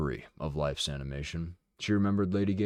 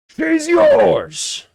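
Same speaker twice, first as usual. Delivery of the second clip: much higher, much louder scream; 18 dB louder than usual. A narrator's reading. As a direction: hurl it at the crowd. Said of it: "Bodden screamed".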